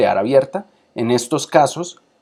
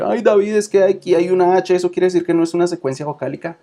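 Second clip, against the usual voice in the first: confused student; 7 semitones higher, faster than usual. Retold or imitated. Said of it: "No se vayan a confundir".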